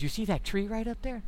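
soft, hushed question. Retold or imitated. Partner quietly pointing the hunter to the tree, hushed. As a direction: keep it down in the woods.

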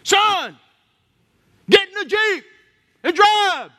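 high, loud barked command; sergeant's order. Retold or imitated. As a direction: bark it like a sergeant under fire. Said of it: "the sergeant looks at him, he goes".

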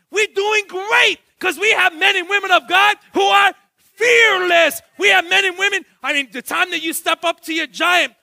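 loud, high preacher's call; full-force preaching. Acted. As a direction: preach it out to the whole church.

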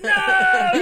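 high, loud hammed-up refusal; mock mom yell. Mom in the nighttime book, mock screaming. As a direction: shriek it like the book's scary mom.

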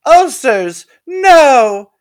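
very high, loud desperate shriek. A narrator's reading. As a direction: scream out in alarm. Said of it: "Shady Business shrieked".

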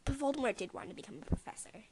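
secretive whisper. Real, not acted. Whispered aside.

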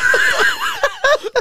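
real laughter, shrill and loud; high shrieking laugh. Real, not acted. Laughing hard at the weird nightclub threat, shrieking. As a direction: let the laugh burst out high.

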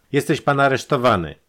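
loud, high declaration of arrest; arrest announcement. A narrator's reading. Detective seizes and handcuffs his victim.

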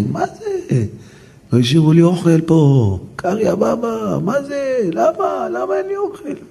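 high, wailing shout of a hungry beggar; poor man shouting. Retold or imitated. Beggar at the wedding door yelling, high and outraged. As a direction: cry out in outrage that no food was left. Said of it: "צועק".

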